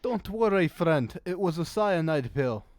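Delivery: put-on a vague Eastern European merchant accent, lightly done; sly salesman voice with an unplaced European accent; mystery European accent. Shady merchant reassuring a customer, in a put-on European accent. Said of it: "don't ask me what this accent is".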